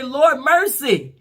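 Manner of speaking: high, loud, slow plea; drawn-out loud prayer cry; heartfelt cry for mercy. Shown as a demonstration. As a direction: wail Lord have mercy from the gut. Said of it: "Sometimes we probably need to say".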